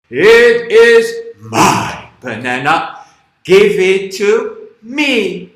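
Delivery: loud, very slow crocodile voice; big-mouthed crocodile. Acted. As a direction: stretch each word out loudly, mouth wide open. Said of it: "crocodile, he opens his big mouth".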